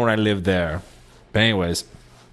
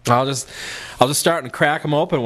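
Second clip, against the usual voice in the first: normal talking voice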